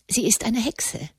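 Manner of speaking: a soft, unvoiced hush on the accusation; whispered. A narrator's reading. Elise whispering to her cousins that Katharina is a witch. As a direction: lean in and whisper the secret.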